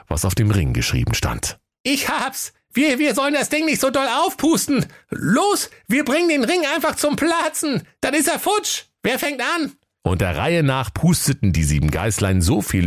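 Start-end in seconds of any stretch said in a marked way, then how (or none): 1.8-9.7 very high eager goat voice; excited goat kid. A narrator's reading. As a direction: blurt the idea like an excited kid. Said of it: "das Geißlein Günther". (aufgeregte hohe Geißleinstimme)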